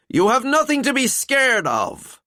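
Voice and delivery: high, loud insistence; insisting loudly. A narrator's reading. Skullduggery insisting to the panicking pair, loud. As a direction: press the point over the noise. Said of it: "insisted the living skeleton".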